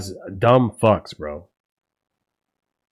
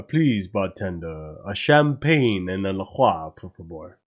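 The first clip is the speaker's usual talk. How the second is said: mock French order; 6 semitones lower, 6 dB quieter than usual. Streamer pretending to order in a French accent. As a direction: order like a posh French customer. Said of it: "that's not French".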